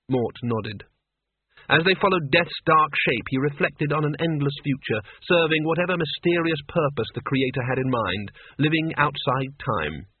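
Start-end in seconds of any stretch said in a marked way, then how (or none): none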